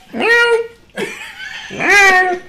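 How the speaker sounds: high mock cat meow. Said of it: "Even the cat is like".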